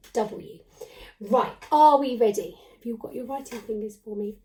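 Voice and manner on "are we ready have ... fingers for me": ordinary delivery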